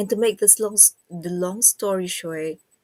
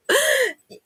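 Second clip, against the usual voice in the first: high burst of laughter; 6 dB louder than usual. Real, not acted. Laughs after teasing herself.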